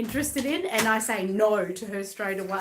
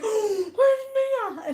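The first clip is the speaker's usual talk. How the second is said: much higher, slow, louder panicked call; mock gasp of panic; 13 semitones higher, 6 dB louder, much slower than usual. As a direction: imitate a friend panicking over a missing doll. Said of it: "she goes".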